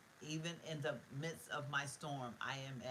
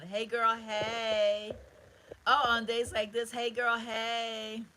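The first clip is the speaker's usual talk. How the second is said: loud, high sing-song hello; excited greeting; 6 semitones higher, 11 dB louder, much slower than usual. Real, not acted. To a viewer joining the stream, loud high sing-song greeting.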